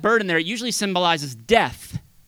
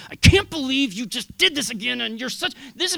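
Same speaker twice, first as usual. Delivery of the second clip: scolding; 8 semitones higher, 4 dB louder than usual. Pretend. Quoting a scolding church.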